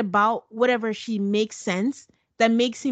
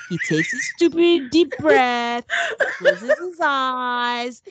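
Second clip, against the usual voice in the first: laughing while describing his big breath; half-laugh through the words; giggling disbelief; laughing mockery; 4 semitones higher, 3 dB louder, slower than usual. Real, not acted. Mocking husband's dramatic breath.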